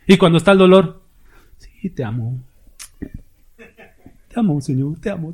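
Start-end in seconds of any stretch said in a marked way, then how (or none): 1.6-5.3 mock lazy prayer. Mocking lazy love declaration.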